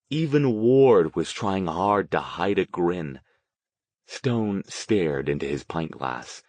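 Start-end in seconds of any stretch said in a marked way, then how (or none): none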